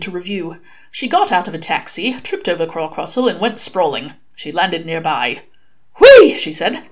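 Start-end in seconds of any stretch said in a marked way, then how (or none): 6.0-6.3 high, loud delighted squeal; gleeful whee. Acted. Virginia squealing with delight after tripping. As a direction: squeal with joy at the tumble.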